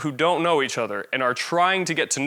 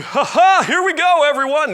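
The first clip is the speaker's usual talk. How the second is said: mock motivational speaker, much higher and louder; hammed-up hype speaker; 12 semitones higher, 7 dB louder than usual. Presenter mocking an inauthentic hype speaker, over-the-top loud. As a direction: overdo the fake hype, high and loud. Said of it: "We don't wanna be inauthentic".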